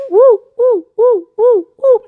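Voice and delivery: string of short, high hoots; celebratory whooping. Real, not acted. Host applauds the fastest viewer, celebratory whooping.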